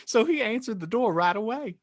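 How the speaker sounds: usual speaking voice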